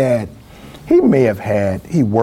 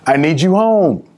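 mother's Southern drawl, pitched higher; 4 semitones higher, 4 dB louder than usual. Retold or imitated. Mother on the phone to son, Southern drawl. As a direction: imitate a Southern mama laying down a request. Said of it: "in her southern drawl".

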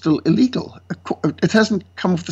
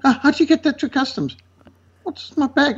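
high, scandalised church elder; shocked gasp; 8 semitones higher than usual. Retold or imitated. Church elder shocked by a pocket knife, high and aghast. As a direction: mimic an appalled elder, pitched well up. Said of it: "one of the elders goes, gasp".

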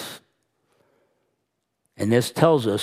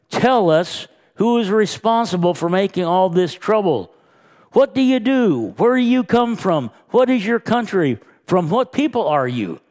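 high, loud demanding questions; angry interrogation; 8 semitones higher, 4 dB louder than usual. Acted. Sailors demanding answers from the fleeing Jonah, high and loud. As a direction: fire questions at him, pitched up and pushing.

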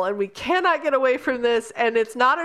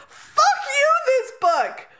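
much higher and louder, laughing through the curse; mock outrage at the book; 7 semitones higher, 3 dB louder, slower than usual. Reader curses the book in a much higher, louder, laughing mock fury. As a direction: throw a hammed-up, laughing tantrum at the book. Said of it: "And I'm like".

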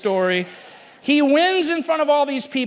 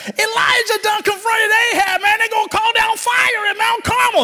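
much higher, loud disbelieving yell; shocked shout; 11 semitones higher, 6 dB louder than usual. Acted. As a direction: shout it like he can't believe his friend missed it. Friend announces the contest.

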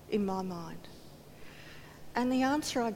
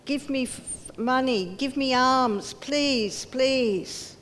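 loud, pleading beggar's cry; mock plea of a lame beggar; 4 semitones higher, 7 dB louder, slower than usual. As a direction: beg for alms, loud and high.

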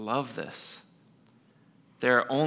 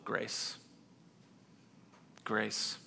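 almost breathed, slow and soft; hushed repeated word; 4 semitones lower, 17 dB quieter than usual. Real, not acted. Preacher answering his own question, hushed and tender. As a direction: let the word grace hang in silence. Answering how it's possible.

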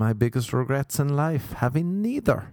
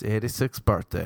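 ordinary talk

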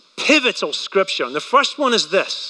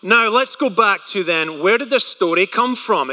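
ordinary talk, nothing marked

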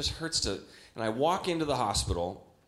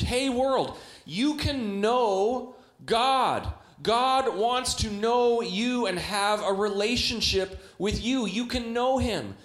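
higher, slow, drawn-out calling to the world; slow, earnest invitation; 8 semitones higher, 3 dB louder, much slower than usual. Acted. Preacher to the world, slow high earnest invitation. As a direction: call it out slowly, like an open invitation. Preacher voicing the hopeful message.

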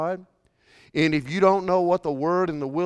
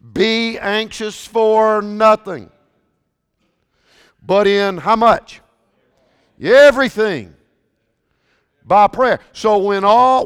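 loud, high, building preacher's cry; fired-up pulpit delivery; preaching at full force; 5 semitones higher, 9 dB louder than usual. Acted. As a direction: preach it hard to the congregation. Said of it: "I almost got a little excited there".

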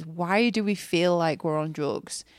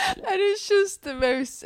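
words broken by real laughter, much higher; laughing through words; 3 dB louder than usual. Real, not acted. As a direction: still laughing as you talk. Still laughing after howling.

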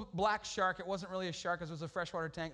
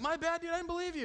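sheepish buddy; 10 semitones higher than usual. Retold or imitated.

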